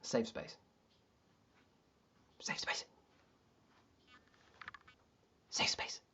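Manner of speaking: hushed, whispered repetition; mock whisper. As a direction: breathe it softly like a mantra. Repeats phrase, sinking to a whisper.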